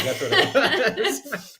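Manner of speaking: laughing while speaking; giggling out the words. Real, not acted. Laughing about bags.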